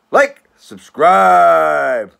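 very slow, stretched sign-off; drawn out. Real, not acted. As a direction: drag out each word lazily. Lazy sign-off.

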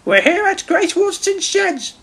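very high-pitched announcement; brave little engine. A narrator's reading. Stanley's brave quote continues.

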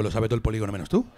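very deep, quick superhero put-down; hammed-up deep hero voice; mock Batman. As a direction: rumble it low as the secret hero. Answers as if he were Batman.

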